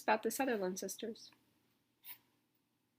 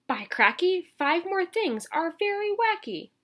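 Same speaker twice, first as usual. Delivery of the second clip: excited exclaim; 9 semitones higher, 9 dB louder, slower than usual. Acted. Exclaims at wacky kitchen.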